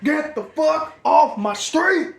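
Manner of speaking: slow, high, loud shout; mocking Rick Dalton yelling at the hippies; mock furious yell. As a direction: bark it slowly like an angry homeowner. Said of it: "joins the Rick Dalton quote".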